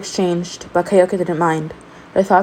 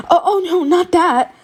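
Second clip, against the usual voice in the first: much higher, hurried alarm; high, panicked backpedal; flustered denial; 12 semitones higher, 3 dB louder than usual. A narrator's reading. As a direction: rush to correct the mistake. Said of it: "Midoriya said quickly".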